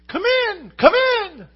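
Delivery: high falsetto come in; high-pitched feminine voice. Acted. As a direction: parrot mimicking a lady's welcome, way up high. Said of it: "a really kind of a high-pitched feminine voice say".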